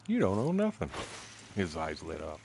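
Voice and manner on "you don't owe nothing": high-pitched mock-puzzled voice; baffled official. Recalling the look on his face, high mock bafflement. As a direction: mimic the clerk, pitched up. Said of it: "The look on his face like".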